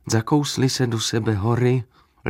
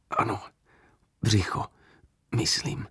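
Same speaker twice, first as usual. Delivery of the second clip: weak whisper; 6 dB quieter than usual. A narrator's reading. Wounded man answering weakly.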